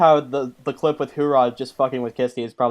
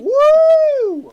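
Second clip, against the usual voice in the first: loud high whoop; cheering; 8 dB louder than usual. Real, not acted. Cheering during the chat.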